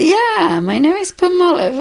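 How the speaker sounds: high-pitched, playful retold reply; joking along. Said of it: "Like, joking around".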